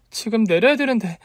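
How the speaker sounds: high-pitched worried realization; flustered. A narrator's reading. 내릴 역에 도착해 당황. (당황해서 높아진 목소리)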